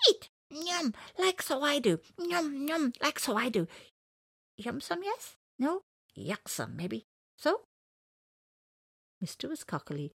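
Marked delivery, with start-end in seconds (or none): 0.1-7.6 very high, coaxing bird voice; cartoon bird voice. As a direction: chirp encouragement like a tiny bird. Bird coaxing him to eat.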